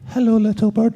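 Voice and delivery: a high, squeaky take of hello, little bird; Hello, little bird with a tight, high squeak; squeaky nervous line. Retold or imitated. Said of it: "And you're going".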